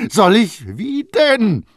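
high, loud incredulous outburst; loud cry. A narrator's reading. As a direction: shout it in his face. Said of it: "rief Baldini mit ziemlicher Lautstärke".

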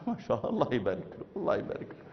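fast, fading to a whisper; hushed Egyptian ma sha Allah; whispered blessing. Retold or imitated. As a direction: mutter the blessing under breath. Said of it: "أخ مصري".

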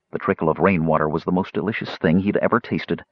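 ordinary delivery

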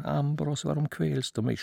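ordinary delivery

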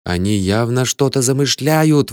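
plain reading voice

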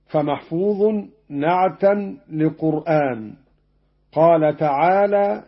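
ordinary talk, nothing marked

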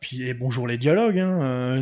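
normal talking voice

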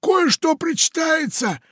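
very high, shouted triumphant announcement; solemn pirate. A narrator's reading. Pirate triumphantly announces the reward to the parrot. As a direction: proclaim it grandly. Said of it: "торжественно сообщил он".

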